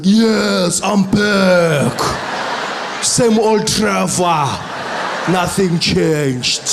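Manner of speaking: loud, slow exaggerated South African accent; overdone accent. Trevor overproving his accent to customs, loud, slow, exaggerated South African accent. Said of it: "I was like".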